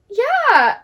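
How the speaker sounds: a sudden very high, loud "Yeah"; squeaky intern. Retold or imitated. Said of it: "And he's like".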